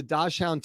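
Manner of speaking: "Dachshund" said as DASH-hound; spelling pronunciation. Real, not acted. Host describing the dog to listeners, "Dachshund" said DASH-hound. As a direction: say "Dachshund" as two full words, DASH-HOUND. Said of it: "But it's pronounced Dachshund".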